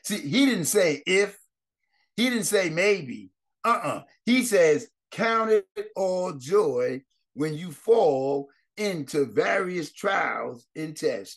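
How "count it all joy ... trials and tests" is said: slow scripture reading. Acted. Scripture quoted slowly.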